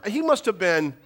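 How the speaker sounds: normal talking voice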